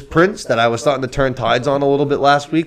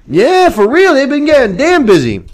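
much higher and louder, gleeful; excited squeal; 8 dB louder than usual. Real, not acted. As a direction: squeal with delight at the gossip. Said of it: "excited reaction to news".

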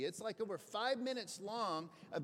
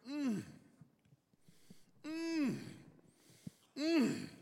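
savoring hums; 7 semitones higher, much slower than usual. Retold or imitated. Man savoring meal with psychiatrist.

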